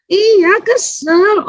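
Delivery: put-on very slow, high whiny child; high drawn-out child's reply; sulky child. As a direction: stretch it out like a grumpy kid. Child answering mother.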